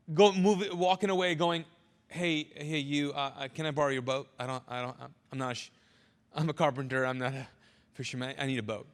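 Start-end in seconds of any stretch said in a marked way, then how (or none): 2.1-8.9 Jesus asking for a boat, low and hushed; imagining Jesus. Retold or imitated. Preacher imagines grieving Jesus begging a boat, low hushed voice. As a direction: mumble it like a shaken man who needs out. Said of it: "could you imagine Jesus going".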